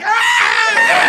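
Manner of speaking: technician mimicking Bobcat's yelp; impression of Bobcat. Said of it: "I do an impression of you".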